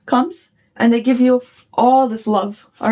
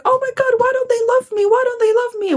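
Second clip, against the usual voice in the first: much higher, wailing "why don't they love me"; mock panicked whine; 13 semitones higher than usual. Mimicking someone freaking out over unnoticed love, high mock wail. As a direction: play the overwhelmed self, squealing in panic. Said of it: "and you're like".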